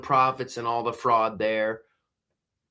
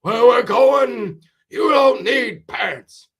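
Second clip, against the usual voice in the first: slow, much higher shouted film line; mock movie shout; 9 semitones higher, 6 dB louder, slower than usual. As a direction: bellow it like a grand film climax.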